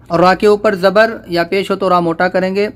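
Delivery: ordinary talk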